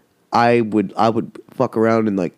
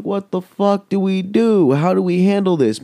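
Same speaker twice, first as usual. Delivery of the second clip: panicked; 11 semitones higher than usual. Retold or imitated. Friends staring at a spider in the toilet: high mock panic. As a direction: sound helpless and freaked out.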